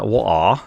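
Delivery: British "What are", t swallowed into a glottal stop; super British "water". Retold or imitated. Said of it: "you turned super British".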